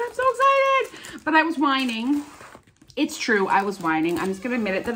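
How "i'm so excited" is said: squealed excitement. Real, not acted. Excited about Canada trip.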